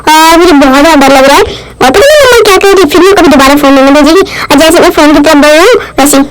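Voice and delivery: put-on cute babyish voice, speaking as the toddler. Mother narrating as her baby to viewers, in a cute baby voice. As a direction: voice the baby's thoughts, playful and sweet.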